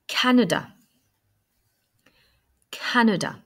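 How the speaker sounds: "Canada" with CAN high and strong, falling away after; stress on first syllable. Shown as a demonstration. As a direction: punch the start of "Canada", middle A a weak "uh". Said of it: "j'insiste bien sur la première syllabe". (« Canada » accentué sur la première syllabe, deuxième A comme un « e »)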